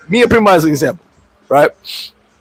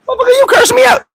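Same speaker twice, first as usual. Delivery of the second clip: mock shout then sweet; 7 semitones higher than usual. Telling his girl the fight was yesterday, high mock yell. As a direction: snap at her, then melt into sweetness.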